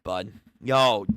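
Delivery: normal talking voice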